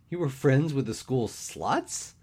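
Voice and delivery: agape. A narrator's reading. Dan gaping at his friend's choice of friends, incredulous. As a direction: ask it slowly, scandalized and incredulous.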